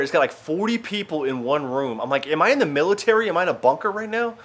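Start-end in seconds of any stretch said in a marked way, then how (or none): 2.3-4.3 high-pitched acted disbelief; rising, baffled questions; incredulous. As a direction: ask it in mock outrage, voice pitched up. Acted disbelief at crowded hostel.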